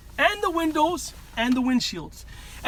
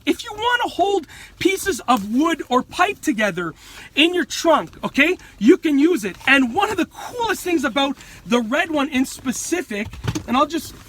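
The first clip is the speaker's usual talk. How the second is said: usual speaking voice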